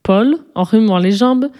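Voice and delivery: usual speaking voice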